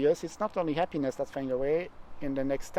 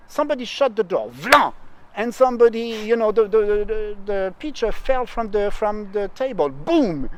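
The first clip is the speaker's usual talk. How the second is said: fast, louder, higher retelling with punchy sound effects; imitating a lively storyteller; 6 semitones higher, 8 dB louder than usual. Coach imitates the poet telling a story with noises, fast and loud. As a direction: ham up the spectacular storyteller, shouting vlan and boom. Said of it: "Madame de Villemorin talked super fast".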